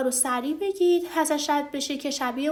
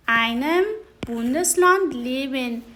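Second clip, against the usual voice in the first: Bundesland leben spelled out slowly; slow, careful teaching read; slow demo; 4 dB louder, much slower than usual. Shown as a demonstration. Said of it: "حرف اضافهٔ داس بوندسلاند این هست".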